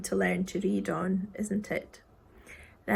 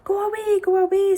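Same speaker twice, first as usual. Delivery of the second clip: high, raised shoo; 8 semitones higher, 6 dB louder than usual. Acted. Boy telling the creatures to go, raised shoo. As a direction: wave them off with your voice.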